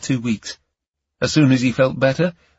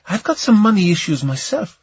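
higher-pitched woman's voice; woman caller; 8 semitones higher than usual. Acted. Camellia cuts Ion off on the phone, higher woman's voice. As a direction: cut in as the woman.

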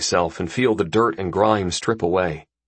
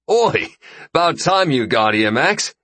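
high-pitched hail to a friend; raised, ribbing greeting; calling out; 8 semitones higher, 3 dB louder than usual. Acted. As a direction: call it out across the pool. Friend calls out greeting from pool.

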